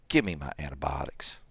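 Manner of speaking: breathy low whisper. Real, not acted. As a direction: hush it under your breath. Whispers while hurt and infected.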